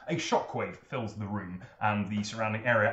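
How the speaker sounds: ordinary delivery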